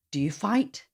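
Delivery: ordinary delivery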